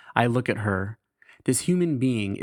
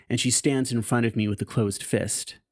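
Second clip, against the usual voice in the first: normal talking voice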